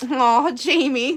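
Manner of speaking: drawn-out, slow, loud "Aw, Jamie"; lightly voiced sympathetic friend. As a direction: coo it in sympathy. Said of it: "I remember Ashley going".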